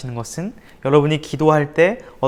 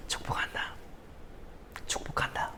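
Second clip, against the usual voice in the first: voiceless soft whisper of blessing; 18 dB quieter than usual. Retold or imitated. Teen to his bullies, softly whispering a blessing. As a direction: bless them in a hush. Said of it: "이렇게 얘기했습니다".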